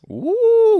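loud high whoop; excited whoop. Real, not acted. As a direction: cheer to kick things off. Hyped cheer starting news.

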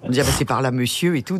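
a hint of a put-on deep male voice; gruff man voice. Actress retelling her day disguised as a man, lightly faking a gruff man's voice. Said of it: "je prenais une grosse voix comme ça".

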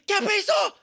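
very high, loud Spanish yell; sudden scream. Acted. Rider to a stranger entering the elevator, screaming. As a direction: shriek it out of nowhere.

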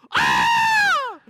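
sudden high loud cry of shock; a startled shriek; jolting yell of surprise; shocked scream. Retold or imitated. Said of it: "and you go".

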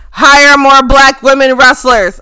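loud emphatic plea; shouted demand. Real, not acted. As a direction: shout it like a slogan.

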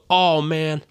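high-pitched imitation of flustered excuses; mocked clueless newcomer. Newcomer from Tennessee excuses himself to biologist, mocked fluster. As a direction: mimic him backpedalling, pitched well up. Retelling newcomer backing down, mocking him.

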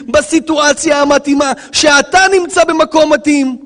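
high, insistent sermon delivery; impassioned preaching. Real, not acted. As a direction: drive each phrase home to the congregation. Preaches the main point forcefully.

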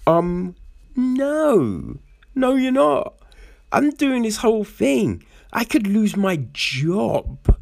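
high, firm no-nonsense refusal; hammed-up refusal, voice up high; mock indignant refusal. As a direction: put your foot down, playing the boyfriend. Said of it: "instead of being like".

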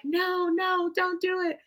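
high, squeaky no no, pleading to be spared; a nervous fan squeaking at her boss not to introduce her; mock panicked plea. Retold panic at being introduced.

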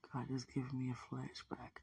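normal talking voice

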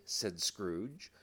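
usual speaking voice

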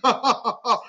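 short scornful laugh; mocking laugh. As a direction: laugh at him like the jeering crowd. Imitating the mocking crowd.

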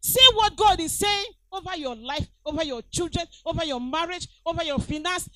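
loud call then rising repeated over your; preaching litany. Acted. Pastor urging church to speak over life, rising preaching. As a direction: build the list like a charge.

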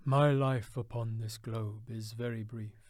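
ghost's reply. A narrator's reading. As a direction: intone like an ageing spirit. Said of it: "replied the ghost".

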